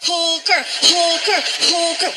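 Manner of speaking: high, drawn-out bratty chant; stretched, repetitive kid whine; kid throwing a fit. Acted. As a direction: chant it like a child having a tantrum. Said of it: "He throws a fit".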